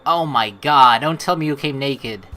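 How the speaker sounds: ordinary talk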